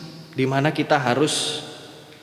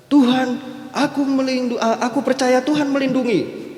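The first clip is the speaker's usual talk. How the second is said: panicked prayer; 7 semitones higher than usual. Retold or imitated. Doa panik air naik seleher.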